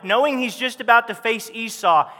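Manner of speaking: ordinary talk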